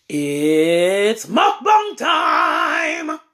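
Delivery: loud, slow drawn-out announcement shout; excited shout. Real, not acted. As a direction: belt it out like a show opener. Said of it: "I got way too excited there".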